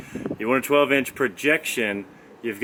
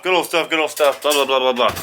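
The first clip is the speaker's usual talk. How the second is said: louder, higher singsong mocking his own catchphrase; self-mocking repetition; 3 semitones higher, 5 dB louder than usual. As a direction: parrot your own phrase to make fun of it. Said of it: "Gotta stop saying that good old stuff".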